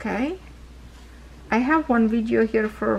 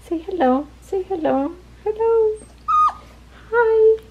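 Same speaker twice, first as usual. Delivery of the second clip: much higher sing-song hello; high pet talk; 11 semitones higher, 3 dB louder than usual. Real, not acted. Owner prompting puppies to greet viewers, high pet talk. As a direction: chirp it up high for the pups.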